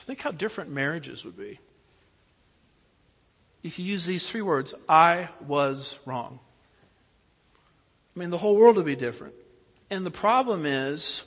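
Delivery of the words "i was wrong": each word of "I was wrong" drawn out and weighted; loud, deliberate "I was wrong"; slow, emphatic admission. Shown as a demonstration. Holds up three words as model.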